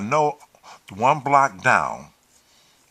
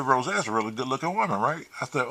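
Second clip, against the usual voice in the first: ordinary delivery, nothing marked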